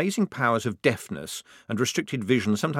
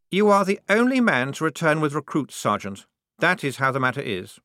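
firm lieutenant; 7 semitones higher, 4 dB louder than usual. A narrator's reading. Voices the lieutenant closing the matter.

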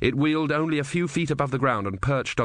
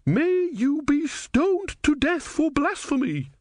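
much higher, slow, indignant; high indignant woman, slow; hotly; slower than usual. A narrator's reading. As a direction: spit it out in pious outrage. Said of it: "said the woman hotly".

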